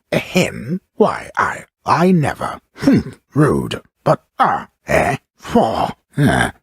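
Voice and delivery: flustered outbursts, high and loud; indignant sputtering. Acted. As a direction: sputter in offended little bursts, pitched high. Indignant reactions amid the narration.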